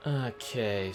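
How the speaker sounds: usual speaking voice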